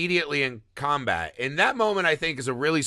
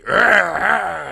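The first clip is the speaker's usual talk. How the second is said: loud, higher growling grunts; monster growl; 5 semitones higher, 8 dB louder than usual. Retold or imitated. The monster growling at the blind man, loud and wary. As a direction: growl like a cornered beast. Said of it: "when he sees the blind guy, and, he".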